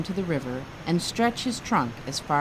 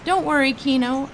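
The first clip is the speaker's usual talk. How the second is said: reassuring call, raised; yelling back; 8 semitones higher, 4 dB louder than usual. A narrator's reading. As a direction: call out over the water. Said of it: "Ernest yelled back".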